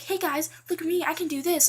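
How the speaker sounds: mock show-off. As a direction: imitate a girl showing off in the gym. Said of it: "she's like".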